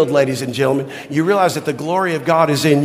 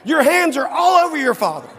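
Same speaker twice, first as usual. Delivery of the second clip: mother scolding; 6 semitones higher than usual. Retold or imitated. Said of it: "She goes".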